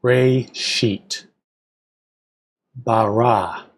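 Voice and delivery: slow, careful reading of Hebrew with each translation; teacher reads the verse to students at a moderate pace; measured reading. Shown as a demonstration. Slow, careful scripture reading. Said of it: "I'll read at a more moderate pace".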